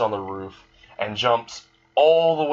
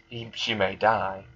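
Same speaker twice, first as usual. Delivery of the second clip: plain reading voice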